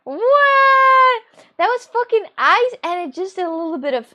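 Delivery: high, very loud indignant wail; outraged shouting. Acted. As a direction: explode at being ripped off. Outraged rant over ice smoothie price.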